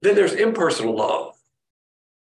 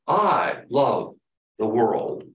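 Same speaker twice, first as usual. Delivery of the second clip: "I" set apart, "the world" let drop low; stress on I; 5 semitones lower, much slower than usual. Shown as a demonstration. Preacher showing God's impersonal love, stress on I. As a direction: lean on I and let "the world" fall away. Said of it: "We call this impersonal love".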